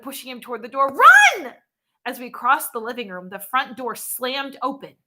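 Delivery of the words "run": panicked shout. A narrator's reading. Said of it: "I shouted".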